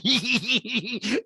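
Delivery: high, put-on giggle in pulses; Rinna-esque cackle. Host mocks reality star, high imitation cackle. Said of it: "the laugh is kinda Rinna-esque".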